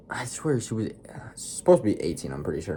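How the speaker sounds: plain reading voice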